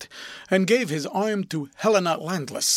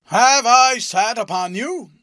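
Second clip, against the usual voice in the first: much louder, higher and slow, a blustering bellow; booming question; 8 semitones higher, 11 dB louder than usual. A narrator's reading. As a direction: boom it down at him. Passenger booms a question.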